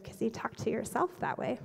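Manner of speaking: very soft, hushed reply; Holy Spirit. Retold or imitated. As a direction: deliver it as a quiet inner correction. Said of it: "the Holy Spirit said".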